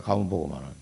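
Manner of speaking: ordinary talk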